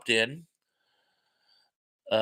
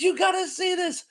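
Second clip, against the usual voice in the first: mock high-pitched wifely squeal; high, excited imitation of his wife; imitating excited wife. Retelling his wife urging him to look, high excited imitation.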